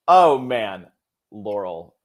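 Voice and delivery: ordinary delivery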